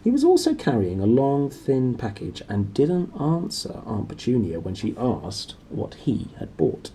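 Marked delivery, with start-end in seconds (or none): none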